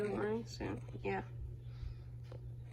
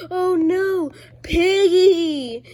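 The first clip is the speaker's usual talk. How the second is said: loud, high cry of alarm; panicked shout; 7 semitones higher, 11 dB louder, much slower than usual. Retold or imitated. Scene: boy sees Piggy coming, loud panicked cry. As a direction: yell it out like the pig just appeared. Character panics in retold game.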